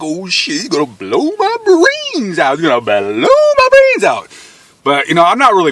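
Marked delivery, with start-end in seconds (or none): 0.0-4.3 mock panic. Gun at his head.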